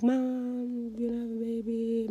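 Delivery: very soft, timid-sounding imitation; whispered confession. Daughter repeating the news to mom, hushed whisper. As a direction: tell it barely above a whisper. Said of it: "I'm whispering, like, just super whispering".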